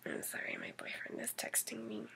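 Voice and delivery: quick whispered aside. Real, not acted. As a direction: drop to a whisper. Aside while checking phone.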